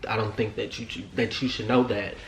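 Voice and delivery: low, soft, halting fluster; choked-up stammer. Retold or imitated. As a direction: trail off and restart, acting tongue-tied. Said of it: "I'm so choked up".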